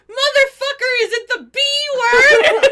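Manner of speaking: put-on loud, high shouted kid question through laughter; kid's shout. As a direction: yell it like a cheeky kid. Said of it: "the little kid goes".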